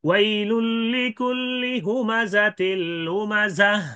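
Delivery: slow, high chanted tilawat; Quranic recitation. Shown as a demonstration. Teacher demonstrating recitation of the verse. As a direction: recite the verse drawn out and melodic. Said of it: "এইভাবে এই যে".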